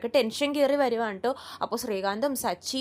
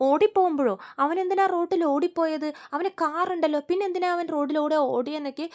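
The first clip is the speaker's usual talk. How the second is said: higher, louder puzzled questions; retold surprise; 5 semitones higher, 4 dB louder than usual. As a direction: ask it like the news makes no sense, mildly. Brother puzzled by accident story. (ഉയർന്ന ശബ്ദത്തിൽ അത്ഭുതത്തോടെയുള്ള ചോദ്യം)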